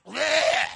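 loud, high comic retch; imitated vomiting. The fish spitting up Jonah, loud mock retch. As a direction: heave it out like a sick fish.

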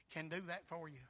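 usual speaking voice

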